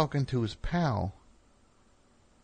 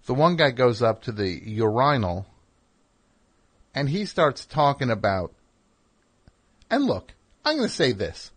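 usual speaking voice

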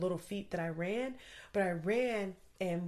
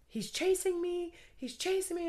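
panicked plea; 11 semitones higher, 3 dB louder than usual. Retold or imitated. Fleeing, on phone with police.

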